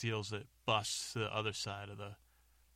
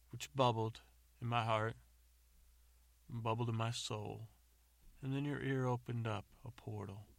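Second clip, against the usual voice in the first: mock-earnest poetic declamation, line by line; terrible love poetry; 4 dB louder than usual. James keeps going with poetry at the suffering beast, declaimed. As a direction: recite bad verse with big pauses. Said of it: "And then James keeps going".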